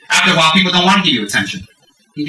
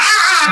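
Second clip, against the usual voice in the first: high mocking cackle; imitated louder laughter. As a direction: shriek with mock laughter. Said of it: "They laugh even louder".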